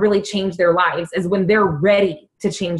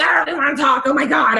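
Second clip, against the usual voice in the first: retold panicked snap; 5 semitones higher, faster than usual. Retelling snapping at mom, a stressed outburst. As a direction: blurt it out like you're overwhelmed. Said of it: "I'm, like, freaking out".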